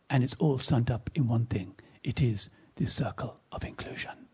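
low-voiced, rough West Indian accent imitation; attempted West Indian accent. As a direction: imitate a Caribbean schoolgirl's accent, low and earnest. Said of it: "I can't do the accent".

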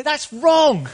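high, loud outraged cry; shout of protest. Pretend. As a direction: shout out at the injustice. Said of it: "we want to shout out".

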